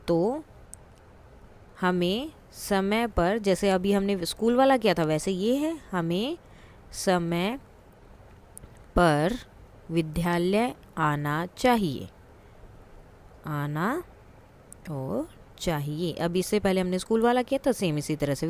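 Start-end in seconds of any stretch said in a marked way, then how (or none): 1.8-3.4 slow dictation. Shown as a demonstration. Teacher dictating sentence. 5.9-16.1 slow, word-by-word dictation. Shown as a demonstration.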